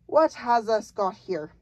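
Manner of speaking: loud booming giant question. A narrator's reading. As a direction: boom it across the cave. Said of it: "His booming voice rolled around".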